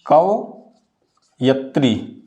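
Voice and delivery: कवयत्री drawn out very slowly, क-व-य-त्री; slow dictation. Shown as a demonstration. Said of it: "कवयत्री हो जाएगा".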